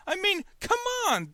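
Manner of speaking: ordinary talk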